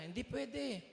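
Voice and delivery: father snaps back, high-pitched; a sharp, irritated no from a busy dad; high, annoyed refusal; angry refusal. Retold or imitated. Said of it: "Ah, nagalit siya".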